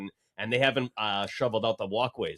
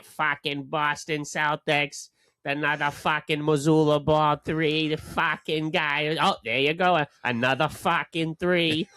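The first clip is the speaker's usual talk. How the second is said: slow, loud mock Boston accent rant, higher pitch; imitating Boston rant; 4 semitones higher, 4 dB louder, slower than usual. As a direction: ham up a Boston fan ranting at the Celtics, slowly. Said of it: "This guy's crazy".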